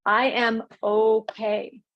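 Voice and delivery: slow, firm "I am okay"; root it into the ground; slow grounded affirmation. Shown as a demonstration. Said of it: "think of your feet grounding".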